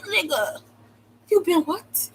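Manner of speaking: high-pitched disbelief; acted-out indignant double take; incredulous retort. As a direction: react in outraged astonishment. Acted retort to his police call.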